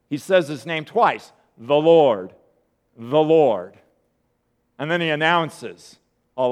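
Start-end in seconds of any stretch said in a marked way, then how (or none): 1.6-3.8 solemn proclamation. Acted. God proclaiming his name.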